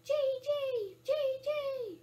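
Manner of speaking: high, loud falling bird cries; imitating a blue jay's sharp call; blue jay shriek. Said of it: "Blue jay's shriek is as sharp as a drill".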